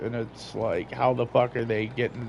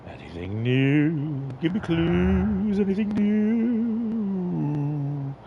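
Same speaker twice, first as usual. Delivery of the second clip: drawn-out mock command; 6 semitones higher, much slower than usual. Player voicing slow game commands.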